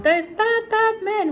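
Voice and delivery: high, loud, cat-like protest; meowing cats. Acted. The cats protest about the bad men, high and loud. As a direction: whine it high like an upset cat. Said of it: "the two cats meowed".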